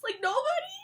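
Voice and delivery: squeaky high disbelief, breaking into a laugh; can't believe nobody finds it cool; amazed nobody at school thinks werewolves are cool; incredulous. Real, not acted.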